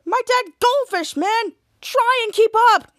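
high, loud, slow exasperation; exasperated retort. Retold or imitated. Dirk correcting the clueless employee, exasperated.

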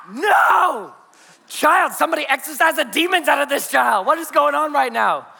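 high, hammed-up desperate outburst; exasperated dad. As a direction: wail it like a parent at wits' end. Exasperated dad reacting to crying baby.

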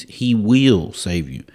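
slow, drawn-out "will"; stress on WILL. Real, not acted. Preacher assuring listeners God saves, stressed WILL. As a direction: press hard and long on "will". Insisting on will, not can.

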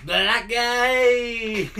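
high, loud shouted greeting; calling out. Retold or imitated. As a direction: yell his nickname across the room. Greeting friend across classroom.

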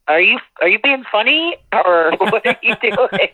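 high, laughing mock of a baffled fan; puzzled Minnesotan. Singer imitates Minnesota audience asking if she's joking, high and laughing. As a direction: lightly play the confused Minnesota crowd, laughing. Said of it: "they look at me like".

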